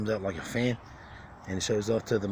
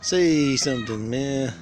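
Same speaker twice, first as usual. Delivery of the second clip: louder, drawn-out demand; loud slow demand; 4 semitones higher, 7 dB louder, slower than usual. Real, not acted. Urging the silent bird.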